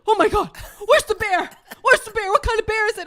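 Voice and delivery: high, loud mock panic; alarmed husband, imitated. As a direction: imitate a duped husband's frantic questions. Retold husband falling for prank.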